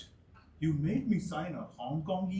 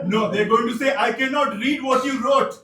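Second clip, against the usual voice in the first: much higher, louder objection; loud protest; 8 semitones higher, 13 dB louder than usual. Acted. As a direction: shout it in protest. Roommates arguing about the paper.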